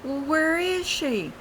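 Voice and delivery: high, slow puzzled call; calling out wondering where she went; friend calling out. Retold or imitated. Friend on the bank asking where she went, puzzled. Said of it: "she was saying".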